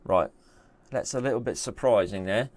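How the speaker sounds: put-on English accent; casual mock-English voice. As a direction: play at being English with a jokey accent. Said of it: "doing it in an English accent".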